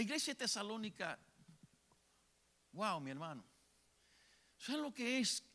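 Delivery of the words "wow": ordinary talk, nothing marked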